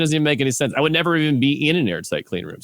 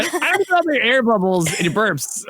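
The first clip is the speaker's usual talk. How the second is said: laughing; 14 semitones higher than usual. Real, not acted. Laughing at burp excuse.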